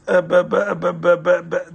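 embarrassed husband stammers before his wife; stammering. Acted. Stammering embarrassed husband. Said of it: "like, stammering".

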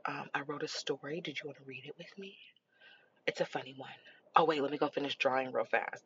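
put-on very quiet, low, hesitant kid; tiny tentative murmur to mom; imitating sheepish daughter. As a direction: imitate a child shyly making up, barely audible. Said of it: "she'll just always be like".

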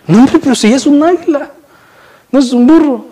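high-pitched mock disbelief; exasperated insisting. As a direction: insist like you can't believe he doesn't see it. Exasperated bystander correcting proud man.

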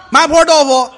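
a restaurant crier's shouted menu item; loud dish-name calling. Acted. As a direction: announce the dish to the whole hall. Reciting dish names on stage.

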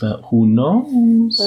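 high, drawn-out, slow mock mystery; dramatic who knows. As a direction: stretch it out like a teaser. Dramatic musing over unknown past.

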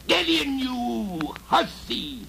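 high, loud goblin snarl; goblin yell. Acted. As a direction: yell it at the girl. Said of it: "he yelled".

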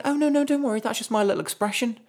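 hammed-up high mum impression, cheerfully dismissive; mum's voice. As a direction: put on a breezy, high-pitched mum voice brushing it off. Mum's excuse for swearing, retold.

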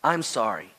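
low, soft, humble apology; sincere apology. Shown as a demonstration. Preacher models the right way to apologize, low and sincere. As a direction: apologize quietly with no excuse. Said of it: "That's the right way to apologize".

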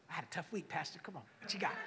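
mock weary churchgoer. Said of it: "walking in there to get their hit".

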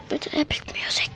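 pleading whisper to Apple Music; a begging whisper aside. Acted. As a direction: whisper the plea, coaxing.

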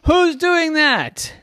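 high, slow wailed protest; indignant kid. Acted. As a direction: call out loudly as a tumbled child. Tumbled Jackson protests.

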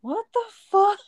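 high, laughing shout of disbelief; shocked outburst. Real, not acted. Listener hears the raunchy food story, shocked shout. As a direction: burst out, appalled and amused.

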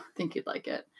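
soft whispered aside; whispering. Real, not acted. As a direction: whisper it under your breath. Same line repeated, unvoiced whisper.

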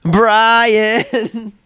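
high, long-held chanting call; mock cheer. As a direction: draw out the name like a crowd chant. Mock cheer calling out a name.

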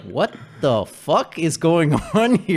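loud, slow, drawn-out incredulity; mock disbelief. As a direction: act appalled, stretching it out with a laugh. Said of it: "And then you're like".

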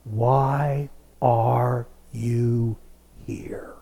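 very slow, soft, fading into a whisper; God gently asking Elijah in a hushed whisper; still small voice. Shown as a demonstration. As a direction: breathe the question out gently, as God's still small voice. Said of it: "in a still small voice".

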